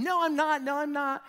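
high, loud mock kid scream; screaming back. Sister screaming back at her brother, loud high denial. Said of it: "scream back to him".

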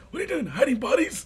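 high, rapid mock holler; Mister Krieg impression. Neighbour teasing Wilkins about digging, high impression. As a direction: imitate the prying neighbour. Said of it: "he was like".

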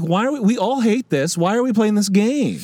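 put-on pleading kid. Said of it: "That was me trying to negotiate".

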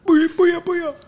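very high-pitched playful cheer; silly high sign-off. Pretend. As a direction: squeal it out in a tiny high voice.

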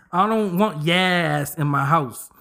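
usual speaking voice

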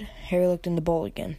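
plain reading voice

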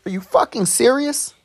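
high incredulous are you serious; retelling how he reacted to the coworker, incredulous; disbelief.

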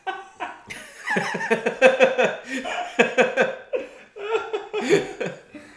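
high shrieking laughter; loud laugh. Real, not acted. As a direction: burst out laughing hard. Hearing licked his taint.

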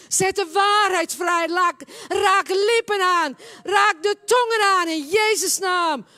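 loud, high, shouted prayer with long cries; impassioned revival preacher at full volume; full-force preaching. Real, not acted. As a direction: cry out commands in fervent prayer.

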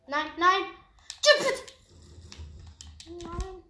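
high, loud panicked cry; panicking gamer. Real, not acted. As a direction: panic and scramble for escape. Gamer panics, grabs jump pad.

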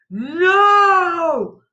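long, high, loud cry of No; heartbroken scream. A narrator's reading. Child seeing parents shot, heartbroken high scream. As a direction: wail it out in anguish. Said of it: "I screamed in heartbreak".

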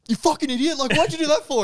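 very high, loud exasperated yell; mock furious yell. Yelling at the friend who set off the alarm, mock furious. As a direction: shriek it at your mate in mock fury.

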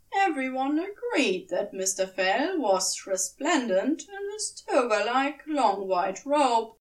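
ordinary delivery, nothing marked

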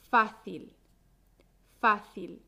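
"fácil" with a firm first syllable, FA-cil; stress on FA. Shown as a demonstration. Teacher modelling the word for learners, "fácil" stressed on FA. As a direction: hit the FA of "fácil", let cil drop. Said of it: "La sílaba fuerte es".

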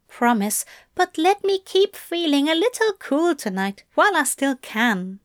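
openly in love Crowley, a little wry; Crowley loving. A narrator's reading. Crowley's voice in narration.

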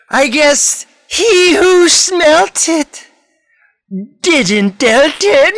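high, slow spooky horror-host voice; jack o lantern voice. Acted. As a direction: ham it up like a Halloween show host. Horror-show host closing the tale.

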